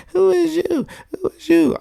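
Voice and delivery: high squeaky mocking "who is you"; mock high doubter voice. Mocking the doubters who question his [name] love, high mock voice. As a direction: parody the haters in a high put-on voice.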